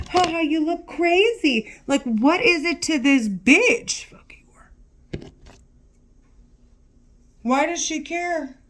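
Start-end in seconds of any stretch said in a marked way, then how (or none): 0.1-1.7 much higher sing-song jeer; mock her like a schoolyard bully; mocking taunt. Ranting woman mimics the voice calling her crazy. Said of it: "and then she keeps saying". 4.1-5.3 whisper. Real, not acted. Muttered insult after rant.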